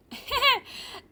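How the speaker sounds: put-on short high girlish chuckle; high character giggle. Girl amused by her confused professor, chuckling. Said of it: "chuckling at my confusion".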